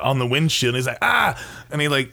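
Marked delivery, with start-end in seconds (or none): 1.0-1.3 short high-pitched imitated cry; mock yell. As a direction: yelp it, hammed-up. Imitates Ernest's cry while spraying.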